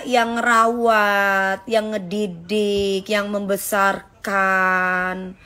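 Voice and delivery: each yang stretched very slowly; slow emphatic list. Real, not acted. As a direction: spell it out word by word, deliberate. Menegaskan dia yang membesarkan. (sangat lambat, setiap yang ditekan)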